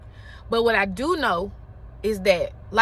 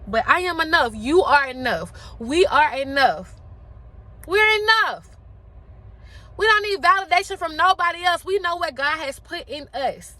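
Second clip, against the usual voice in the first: booming motivational speech; 4 semitones higher than usual. Acted. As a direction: build it up like a rally cry. Rising affirmation.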